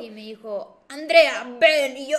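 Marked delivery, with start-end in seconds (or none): 0.9-2.0 loud, commanding summons; angry father shout. Retold or imitated. Angry dad summons daughter to his room, shouting. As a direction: call her in like a cross father. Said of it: "le estaba gritando".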